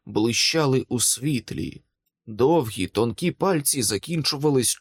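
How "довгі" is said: ordinary delivery, nothing marked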